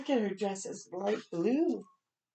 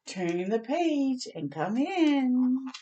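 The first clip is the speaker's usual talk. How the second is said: slow invitation; 6 semitones higher, slower than usual. Acted. Moon invites.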